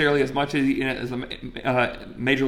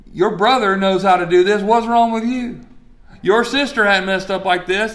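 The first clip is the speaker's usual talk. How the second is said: louder, higher mock parental scolding; 5 semitones higher, 5 dB louder than usual. Parent comparing a child to a brother or sister, scolding. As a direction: voice an exasperated parent comparing siblings.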